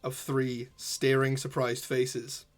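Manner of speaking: slow, hushed scene-setting narration; game master narration. Acted. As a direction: draw the players into the scene slowly.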